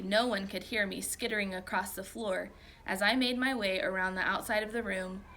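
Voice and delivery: plain reading voice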